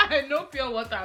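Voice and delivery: laughing. Real, not acted. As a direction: crack up, then talk through the laugh. Cracks up over pure water.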